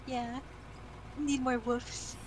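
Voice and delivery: usual speaking voice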